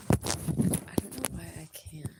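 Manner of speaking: breathy whispered aside; whispering. Real, not acted. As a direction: whisper it under your breath. Muttered aside to herself.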